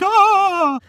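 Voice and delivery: high loud mock shriek of fright; hammed-up startled scream. Teacher voicing Nora caught at the door, hammed-up startled shriek. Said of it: "she's like".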